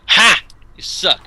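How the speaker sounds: loud, high burst of scorn; gloating taunt. Shown as a demonstration. Showing how a winner treats the guy, gloating. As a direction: bark a triumphant laugh-word, then jeer. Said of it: "you look at the guy".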